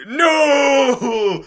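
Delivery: high, loud wail; anguished cry. Acted. Letty reacting in the fanfic with a high, loud cry. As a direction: cry out in horror.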